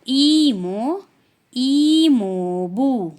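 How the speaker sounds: "ई मो" then "ई मो बू", slow and loud; teacher modelling Korean terms. Shown as a demonstration. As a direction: model each term slowly. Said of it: "mother side वाले में".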